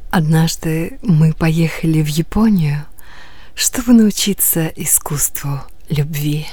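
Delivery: low, seductive, half whispered; sultry low voice. Acted. Sultry woman in ad. (низкий соблазнительный голос)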